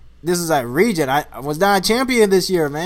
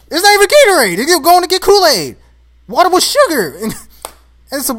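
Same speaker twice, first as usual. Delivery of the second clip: much higher and louder, building outrage; indignant rant; 7 semitones higher, 7 dB louder than usual. Real, not acted. Complaining about slackers drinking Kool-Aid at practice, loud and indignant. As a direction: rant like the injustice still stings.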